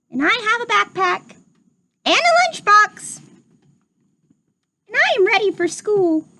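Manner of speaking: high squeaky piglet voice, eager; little pig voice. Acted. Teacher reading Rufus introducing himself, high piglet voice.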